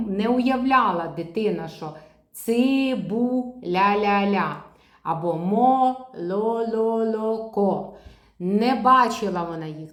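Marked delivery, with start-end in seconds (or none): 2.3-7.9 slow, with ля-ля-ля and ло-ло-ло stretched and beat out; slow syllabified speech for a child; syllable by syllable. Shown as a demonstration. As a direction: tap out each syllable of цибуля and молоко. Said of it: "скільки кружечків, стільки складів".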